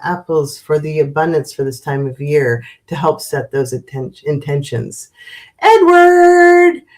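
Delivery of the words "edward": high, loud sing-out of a name; loud, drawn-out high call. Real, not acted. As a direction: hail a friend with a long, high held note. Calling out greeting to a viewer.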